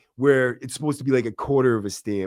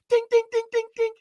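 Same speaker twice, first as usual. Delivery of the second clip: very high falsetto dings; quick ringing metallic pings; imitated metal banging. Imitating knife hitting cell window, metal banging. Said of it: "He's smacking the knife on the window".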